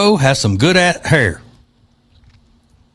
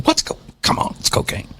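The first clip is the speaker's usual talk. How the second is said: hushed mock aside; 12 semitones higher, 6 dB quieter than usual. Mocking aside after the high voice.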